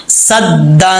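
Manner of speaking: صدن with the nun hidden in a nasal hum, one alif long; ghunna of ikhfa. Shown as a demonstration. Teacher showing ikhfa ghunna on صدن. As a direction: hum the n of صدن through the nose. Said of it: "ایک الف کی مقدار غنہ کریں گے".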